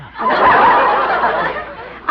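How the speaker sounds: high, loud giggling laugh; trademark giggle. Acted. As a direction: let out a big rolling giggle. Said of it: "his trademark giggle".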